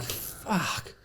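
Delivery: soft, breath-only swear; voiceless sigh of retold dismay; dismayed curse. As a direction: let the curse out under your breath. Acted dismay at the detour.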